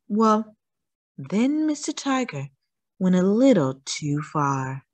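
ordinary delivery, nothing marked

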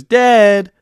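gloating yell with a cracked voice. Real, not acted. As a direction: voice cracking high and loud on a taunt. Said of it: "that was the worst voice crack ever".

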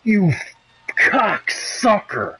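high, slow, stretched scream after a jumpscare; real startled scream, then a drawn-out curse; terrified scream. Real, not acted. Sudden jumpscare in game.

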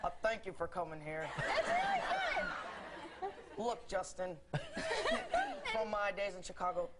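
Obama impression. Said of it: "he was like".

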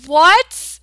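loud startled cry of his name; shocked outcry. Pretend. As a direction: react in outraged shock. Shocked by date proposal.

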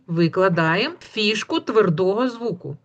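normal talking voice

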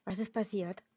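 plain reading voice

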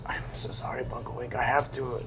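hushed, breathy rug apologizing; timid rug whispering sorry; whispered apology. Acted. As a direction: whisper it like a guilty servant. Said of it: "the rug says".

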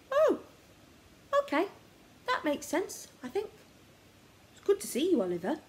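a hint of a relieved boy; lightly voiced boy. A narrator's reading. Mahmood voiced, boy character.

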